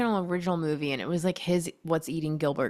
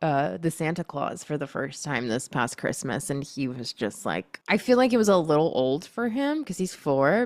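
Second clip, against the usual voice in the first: usual speaking voice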